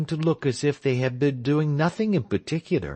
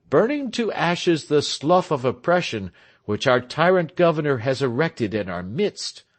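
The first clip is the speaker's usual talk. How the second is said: proud orator; 6 semitones higher than usual. A narrator's reading. Tell making his first speech to the crowd, proud orator.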